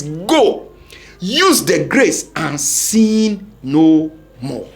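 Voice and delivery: slow, loud and weighty final message; slow emphatic preaching. Shown as a demonstration. Preaching the final message to churchgoers, slow and loud. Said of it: "final message of grace is".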